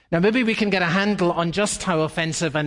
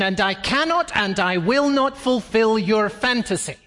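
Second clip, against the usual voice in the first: higher, wounded and indignant; indignant Heather; 6 semitones higher than usual. Retold or imitated. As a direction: she refuses him with offended dignity. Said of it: "she will say".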